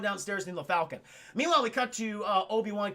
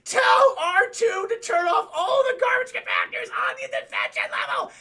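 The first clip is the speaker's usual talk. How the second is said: high, loud hammed-up scream; screamed order; 12 semitones higher, 8 dB louder than usual. Luke to droid over comlink, frantic scream. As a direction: scream every word, pitched way up.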